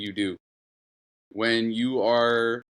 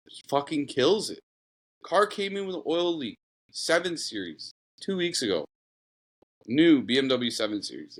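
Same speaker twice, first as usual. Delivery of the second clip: ordinary delivery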